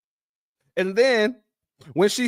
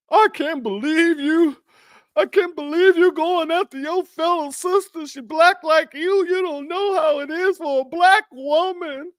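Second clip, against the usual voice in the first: higher, scolding mammy voice; Democrat mammy; 6 semitones higher than usual. Retold or imitated. Commentator mocks a Democrat mammy scolding her sister. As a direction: play the indignant mammy calling out her sister. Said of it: "the mammy is gonna be more".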